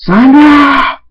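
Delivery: long, high, full-voiced yell; battle-cry shout; furious shout. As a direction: bellow the enemy's name, drawn out. Prince roars villain's name.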